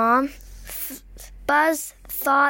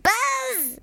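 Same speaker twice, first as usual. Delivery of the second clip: crying fly buzz; 11 semitones higher than usual. Acted. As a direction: call out high and thin like a caught fly. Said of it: "The fly cried".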